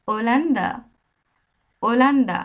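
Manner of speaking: ওলান্দা with a silent h; Spanish pronunciation. Shown as a demonstration. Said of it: "স্প্যানিশে বলে".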